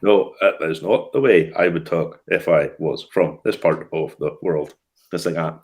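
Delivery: slow, low, stilted Ulster voice; Ian Paisley. Retold or imitated. Mocking an actor's awful accent, stilted low Ulster impression. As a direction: drag each word out in a stiff Ulster accent. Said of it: "he sounds like a very stilted Ian Paisley".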